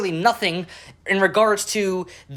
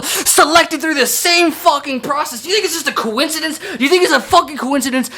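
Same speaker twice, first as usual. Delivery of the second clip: ordinary talk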